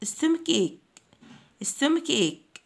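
"stomach ache" with a k sound in both words. Shown as a demonstration. Teacher models new vocabulary for pupils, CH said as K. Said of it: "السي والاتش بننطقهم كي".

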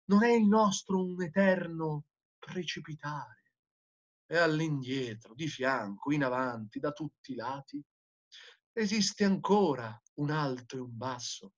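soft, low, troubled questioning; subdued declamation. A narrator's reading. Madman asking if we fall forever, hushed dramatic questioning.

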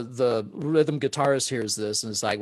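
ordinary delivery

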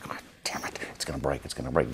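hushed, urgent whisper under his breath; panicked whisper. Retold or imitated. Muttering in alarm while the puppeteers blink the fragile puppets, whispered. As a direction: whisper it like you're afraid to be heard.

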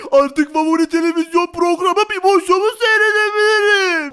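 big-voiced, gushing [name]; delighted friend [name].

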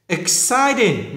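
"exciting" slow, high on the second syllable; nhấn âm hai. Shown as a demonstration. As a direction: lean long on CIT in "exciting". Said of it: "nhấn âm hai". ("exciting" nhấn mạnh âm hai)

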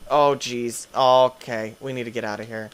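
normal talking voice

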